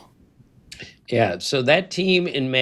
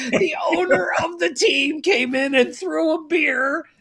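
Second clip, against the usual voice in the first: shouting laughter; 12 semitones higher than usual. Real, not acted. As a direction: laugh out the disbelief. Laughing at absurd story.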